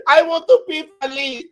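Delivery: high, put-on Dominican accent; laughing, high-pitched Dominican-accented English. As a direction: imitate yourself slipping into a Dominican accent. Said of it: "Look, I start talking like that".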